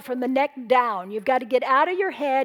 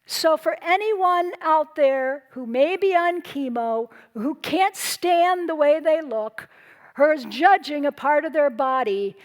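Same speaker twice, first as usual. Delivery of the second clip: ordinary talk, nothing marked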